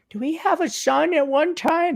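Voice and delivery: high, soft puzzled parent voice; wondering parents. Retold or imitated. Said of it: "they're wondering".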